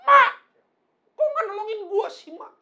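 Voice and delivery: high, loud protest shouted at the top of the voice; high-pitched aggrieved shouting; indignant outcry. Acted. Acts out indignant falling eaglet.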